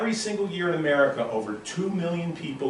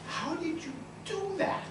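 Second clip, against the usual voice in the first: put-on high, soft, wide-eyed kid voice; amazed little boy; 10 dB quieter than usual. Boy asking his uncle about the trick, high and amazed. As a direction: ask it like a stunned ten-year-old.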